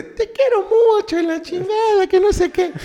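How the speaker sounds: very high squeaky doll; sing-song toy doll voice. Retold or imitated. Doll voice in retelling.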